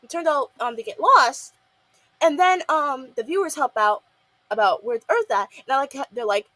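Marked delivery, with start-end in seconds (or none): none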